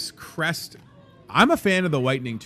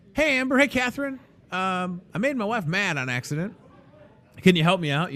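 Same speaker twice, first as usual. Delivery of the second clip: high, pleading customer asking for help; sheepish customer; 6 semitones higher, faster than usual. Acted. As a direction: beg the florist to fix it.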